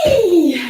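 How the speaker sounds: high falling "wheee!"; high "whee". Shown as a demonstration. Teacher does the biggest leap, high "whee". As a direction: let out a whee as you jump.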